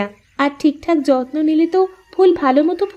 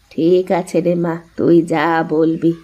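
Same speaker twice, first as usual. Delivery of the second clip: put-on lower, slow, older mother giving in; mother-in-law agreeing to what her daughter-in-law wants; indulgent mother-in-law; 8 semitones lower than usual. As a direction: let her have her way, slowly.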